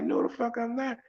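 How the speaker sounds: high-pitched defensive retort; acted-out affronted comeback; retold indignation. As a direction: protest the accusation, voice pushed up high. Acts his indignant retort.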